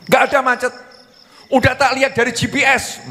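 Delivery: high, insistent contradiction; acted suspicious wife; challenging wife. Acted wife disputes traffic excuse.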